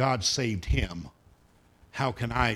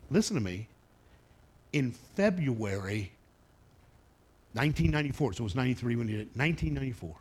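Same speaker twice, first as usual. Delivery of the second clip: normal talking voice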